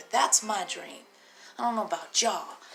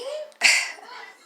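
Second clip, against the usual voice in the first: high squealing laugh, louder; high giggle; 6 dB louder than usual. Real, not acted. Laughing at green lover joke.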